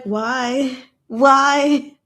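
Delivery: incredulous why. Retold or imitated. Retelling her reaction to the rules, an incredulous why. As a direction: repeat why in disbelief. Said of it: "I'm just like".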